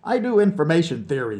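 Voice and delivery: ordinary delivery, nothing marked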